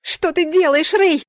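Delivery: high, loud outraged yell. A narrator's reading. Heroine held fast by guard, yelling. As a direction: yell at him in alarm. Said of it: "заорала я".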